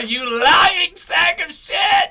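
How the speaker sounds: slow, high, loud yell; shouting. Real, not acted. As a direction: bellow it out slowly. Yelling at the ad.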